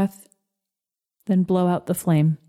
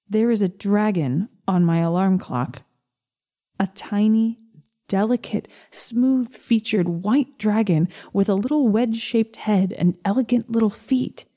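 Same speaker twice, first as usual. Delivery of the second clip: ordinary delivery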